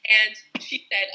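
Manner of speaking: ordinary delivery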